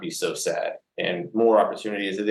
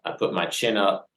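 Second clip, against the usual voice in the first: ordinary delivery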